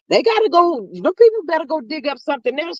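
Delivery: ordinary delivery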